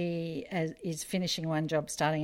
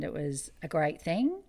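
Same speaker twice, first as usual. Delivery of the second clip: normal talking voice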